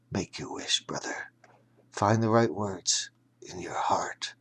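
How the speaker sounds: faint breathy whisper; barely voiced, fading last words; dying whisper to a brother; whispered farewell. A narrator's reading.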